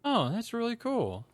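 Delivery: lightly voiced encouraging big brother; supportive, encouraging. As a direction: imitate a proud dad cheering on a kid. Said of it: "his tone immediately changes to".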